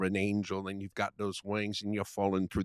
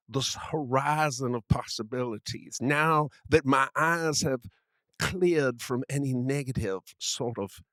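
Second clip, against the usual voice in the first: put-on Southern gentleman accent. Friend keeps up the Southern gentleman bit, mild Southern accent. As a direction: grandly declaim as a genteel Southern gentleman. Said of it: "I must use this voice".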